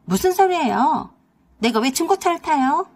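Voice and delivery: sister-in-law hopping mad over a used car; indignant outburst. Acted. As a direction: blurt it out, offended and worked up. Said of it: "팔짝 뛰면서 그랬습니다".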